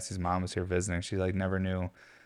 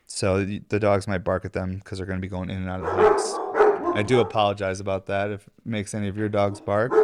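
ordinary talk, nothing marked

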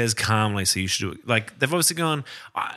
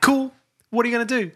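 mock casual; 10 semitones higher, faster than usual. Mocking Gambon's casual attitude.

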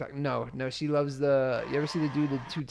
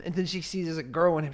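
ordinary talk

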